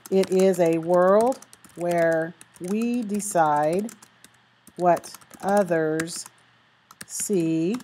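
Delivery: slow reading fading to very soft. Shown as a demonstration. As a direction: let the sentence sink away, slowly and softly. Reads the model conclusion, fading.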